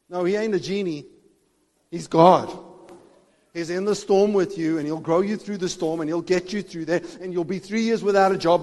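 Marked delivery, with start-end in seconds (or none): none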